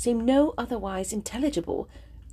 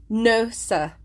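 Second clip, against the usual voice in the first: a firm, louder no; firm refusal; 4 dB louder than usual. A narrator's reading. Eleanor refusing Willoughby, firm. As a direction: refuse him flatly and firmly. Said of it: "she replied with firmness".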